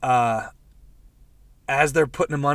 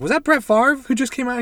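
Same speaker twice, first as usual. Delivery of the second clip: high, amazed question; surprised question; 10 semitones higher, 4 dB louder than usual. Retold or imitated. Paul Rudd asking the receptionist, amazed. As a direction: ask it in disbelief. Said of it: "He's like".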